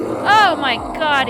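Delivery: loud slow exclamation. Real, not acted. Gamer reacts as the werewolf keeps growling, loud drawn-out exclamation. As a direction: let the disbelief spill out slowly.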